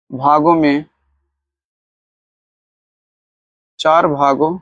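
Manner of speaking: words spaced out with long pauses; slow dictation. Shown as a demonstration. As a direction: dictate it so students can copy. Teacher dictates the sentence.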